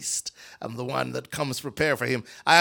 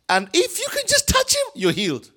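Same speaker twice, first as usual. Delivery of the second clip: excited; 4 dB louder than usual. A narrator's reading. Preacher's excited telling.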